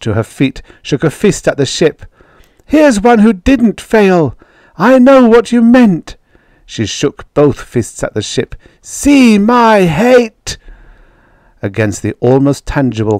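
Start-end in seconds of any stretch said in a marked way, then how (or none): 2.6-6.2 furious high-pitched yell; screaming. A narrator's reading. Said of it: "she screamed". 8.8-10.6 very high, loud, slow scream; furious drawn-out shriek; screaming hatred. A narrator's reading. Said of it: "she screamed".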